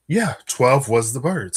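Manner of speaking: ordinary talk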